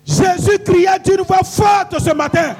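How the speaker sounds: loud, high-pitched rallying shout; full-voiced, high declamation; shouted preacher's call. Real, not acted. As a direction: shout the verse to set off the crowd. Preacher shouts cue to congregation.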